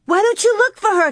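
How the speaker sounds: high, loud, frantic outcry; crying out. A narrator's reading. Carrie urging Laura to search, crying out frantically. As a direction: cry it out frantically, pitch way up. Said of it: "Carrie cried".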